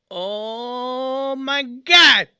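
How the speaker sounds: long drawn-out exclamation; stunned by a first heavenly bite; blissful amazement. Retold or imitated. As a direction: savour it, stretching the words right out. Ecstatic reaction to first bite.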